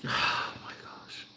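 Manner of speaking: very soft, slow whisper; barely voiced, spent from crying; overwhelmed whisper. Real, not acted. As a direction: whisper it to yourself, overcome. Overcome after crying about ancestors.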